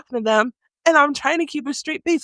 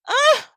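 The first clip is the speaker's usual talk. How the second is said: high mock cry; sudden hammed-up high yelp; imitated noise. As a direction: imitate the embarrassing cry from the car. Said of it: "I hear these noises".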